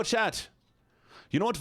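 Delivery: usual speaking voice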